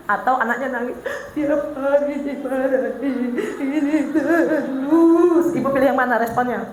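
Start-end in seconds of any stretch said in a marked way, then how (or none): 1.4-5.5 slow, sing-song mock crying; mock whining child. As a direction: drag it out like a sulky crying kid. Child crying about scolding. (anak menangis mengeluh dimarahi, rengekan pura-pura yang lambat)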